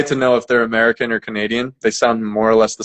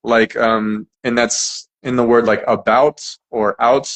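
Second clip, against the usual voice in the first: ordinary talk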